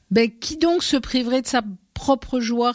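high, strident insect voice; the grasshopper mocking the mother, strident and high. A narrator's reading. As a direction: scoff at her in a piercing little voice. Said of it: "d'une voix stridente".

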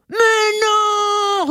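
cri très aigu et rugi; rugissement indigné. A narrator's reading. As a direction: rugis ton refus comme un lion. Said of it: "rugit le lion". (rugissement de lion indigné)

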